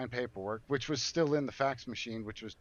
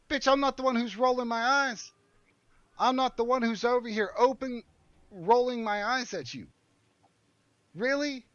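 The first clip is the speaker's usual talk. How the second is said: much higher, louder, outraged voice; indignant outburst; 11 semitones higher, 5 dB louder than usual. Retold or imitated. At the receptionist who keeps rolling her eyes, outraged. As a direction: fling the accusation back, outraged.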